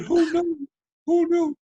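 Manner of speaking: very high, loud mock shriek; incredulous outburst. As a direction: squawk it in hammed-up disbelief. Repeating producer's line in disbelief.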